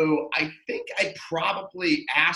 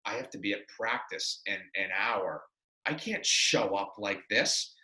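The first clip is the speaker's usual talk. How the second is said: low, soft retold worry; hushed dread; 7 semitones lower, 9 dB quieter than usual. He must face practice with hickeys, quietly dismayed. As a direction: think it aloud under your voice, dismayed.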